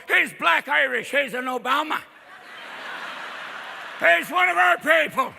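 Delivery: high, loud Irish cheering; proud Irish voters. Acted. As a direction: claim him loudly as one of your own. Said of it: "some Irish people going".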